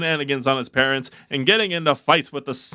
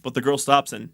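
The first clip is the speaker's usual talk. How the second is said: normal talking voice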